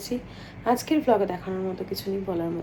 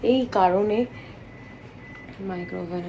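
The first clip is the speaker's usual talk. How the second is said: ordinary delivery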